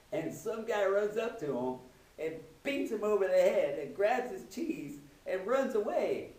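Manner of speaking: ordinary talk, nothing marked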